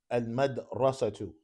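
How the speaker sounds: slow, measured model pronunciation; dictation for repeating. Shown as a demonstration. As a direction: say it slowly for the class to repeat. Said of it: "after me".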